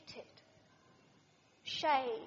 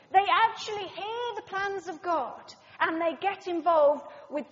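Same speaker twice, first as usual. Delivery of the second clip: louder, driving sermon emphasis; preaching at full force; 4 semitones higher, 9 dB louder than usual. Acted. Preacher urging the congregation, rising emphatic preaching. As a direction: build the point like a preacher rallying the room.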